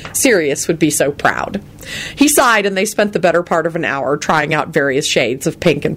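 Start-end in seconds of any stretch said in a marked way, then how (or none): none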